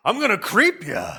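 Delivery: loud spooky catchphrase. Acted. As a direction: deliver the TV catchphrase. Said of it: "it's his catchphrase".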